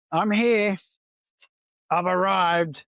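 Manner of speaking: high, drawn-out announcement of arrival; mock-grand arrival. New worker announcing himself at the workplace, mock-grand.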